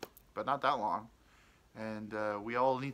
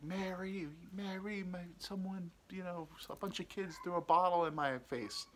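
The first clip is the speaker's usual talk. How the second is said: higher, softer put-on dad voice; imitated father; 7 semitones higher, 4 dB quieter than usual. Father explains his bloody face to mom, softly imitated. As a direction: play dad explaining himself, pitched up and hushed. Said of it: "And he's like".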